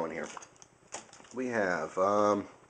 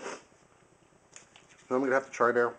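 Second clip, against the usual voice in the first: mouth full; 4 semitones higher, much slower than usual. Real, not acted. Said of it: "trying not to talk my mouth full".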